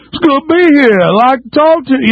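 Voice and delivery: very high put-on LA dialect; mock Hillary Clinton LA accent. Host mocking her pandering in L A, exaggerated LA accent. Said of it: "she'll go to L A and go".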